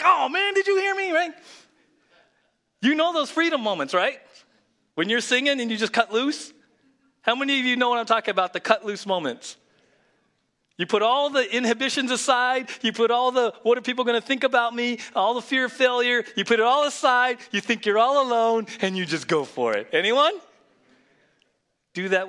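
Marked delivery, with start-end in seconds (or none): 0.0-1.3 high, loud, caught-out cry; mock embarrassed alarm. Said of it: "Until you find out someone was home". 2.8-20.4 high, loud, urgent preaching. Acted. Preaches at full force.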